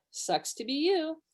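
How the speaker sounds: slow, high mock taunt; gloating. Wife spelling out how her husband sounded, mock gloating. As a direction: imitate a singsong lack of sympathy. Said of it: "sounded like".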